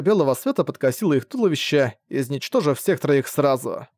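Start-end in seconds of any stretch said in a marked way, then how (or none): none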